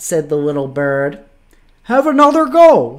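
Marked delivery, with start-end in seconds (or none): 1.8-3.0 high, loud and quick talking dog; dog's voice. A narrator's reading. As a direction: urge it on in a raised, brisk voice. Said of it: "said the dog".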